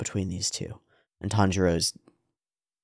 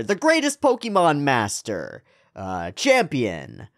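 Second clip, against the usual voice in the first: shonen hero; 6 semitones higher, slower than usual. Pretend. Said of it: "shonen protagonist speech".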